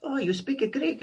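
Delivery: high, accented, mock-amazed owner; Greek restaurant owner. Restaurant owner discovering a fellow Greek, high-voiced mock amazement. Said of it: "He goes".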